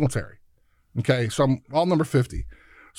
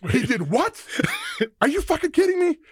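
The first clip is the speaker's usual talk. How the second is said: the coach can't believe what he hears; outraged coach; 13 semitones higher than usual. Retold or imitated. Coach outraged at illegal offer.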